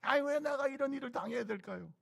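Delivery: high, whining grumble; high, whiny mock complaint. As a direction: whine like a self-pitying believer. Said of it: "원망, 불평".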